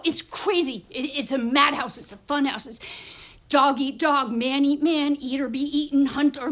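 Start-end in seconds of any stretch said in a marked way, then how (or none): none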